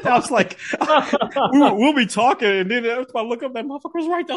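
talking through high-pitched giggles; can barely get the words out for laughing. Real, not acted. Cracking up to his friend about the waiter hovering, laughing.

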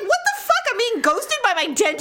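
high, incredulous outburst; mock outrage. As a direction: act scandalised at being dumped. Acted outrage at being ghosted.

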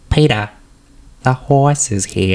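slow sing-song cartoon mom voice calling Peter; Lois Griffin impression. Shown as a demonstration. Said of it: "then I'm gonna do it after".

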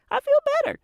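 put-on high, light child's relief; relieved kid voice. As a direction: play the relieved little boy. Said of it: "he's like".

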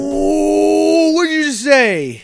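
high, loud, slowed-down shocked oh; mock outrage. Friend feigns horror at the Gundam insult, high and loud. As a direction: gasp at the insult in hammed-up horror.

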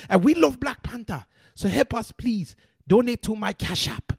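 exaggerated African accent, pleading and indignant; mock African accent. Host fake-hijacks his broadcast, mock African accent. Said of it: "that is my impression of how they sound".

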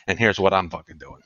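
ordinary talk, nothing marked

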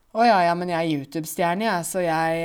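loud, hammed-up show-off; mock boasting, big and self-important; full of themselves. Teacher mimicking a conceited YouTube star, loud mock bragging. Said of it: "litt sånn høy på seg selv".

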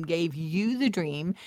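ordinary talk

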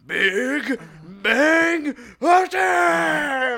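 high, drawn-out battle shout; Vegeta's attack yell. Retold or imitated. Imitating Vegeta firing at the android, attack yell. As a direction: bellow the move name slowly, stretching each word. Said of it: "Vegeta's like".

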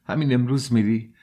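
high, sharp cry of dismay; cry of alarm. A narrator's reading. Mother crying out as her son says he is leaving. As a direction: burst out in alarm, voice jumping up. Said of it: "پولچریا فریاد زد".

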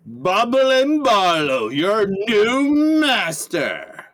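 very slow, drawn-out proclamation; grandiose announcement. Acted. Barlow introducing himself to the stone, grandiose. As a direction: stretch every word like a pompous new master.

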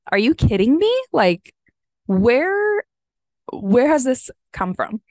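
shocked at herself, pitch jumping on where; amazed disbelief. Real, not acted. Amazed at sticking to program.

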